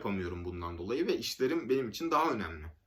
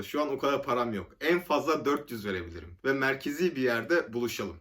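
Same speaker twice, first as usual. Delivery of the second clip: usual speaking voice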